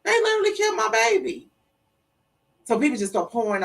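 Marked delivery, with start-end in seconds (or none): 0.0-1.5 high, quick cry of grief; stricken realization. Retold or imitated. Mother repeats that her baby was killed, stricken. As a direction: say it in anguished disbelief, pitch way up.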